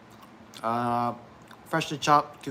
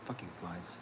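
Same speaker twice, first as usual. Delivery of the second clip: ordinary delivery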